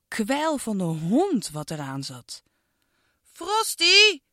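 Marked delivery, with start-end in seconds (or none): 3.3-4.2 high, loud cross call of the dog's name; angry call. Acted. Girl scolds her dog, high angry call. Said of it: "riep Iris boos".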